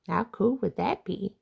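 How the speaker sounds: usual speaking voice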